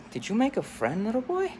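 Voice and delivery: high sing-song question; cooing counselor. Acted. Counselor delighted the orphan boy made a friend, cooing. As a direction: lift it sweetly, ending way up. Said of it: "one of the counselors".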